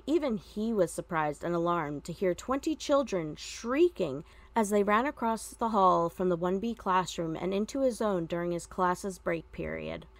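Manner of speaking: usual speaking voice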